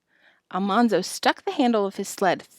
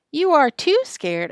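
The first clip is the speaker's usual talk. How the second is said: slow, higher taunt; taunting retort; 7 semitones higher, 3 dB louder than usual. A narrator's reading. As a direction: drag it out to tease him. Boys taunt Louie.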